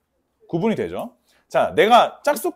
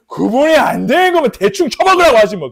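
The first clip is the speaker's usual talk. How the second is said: loud, raised-pitch mock grumbling; exasperated rant; 7 semitones higher, 8 dB louder than usual. Giver fed up with being asked about sharing, exasperated rant. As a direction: rant dismissively, pushing the volume up.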